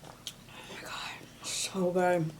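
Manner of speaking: slow awed whisper; whispered delight. Real, not acted. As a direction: breathe it out, savoring. Tasting food, whispered delight.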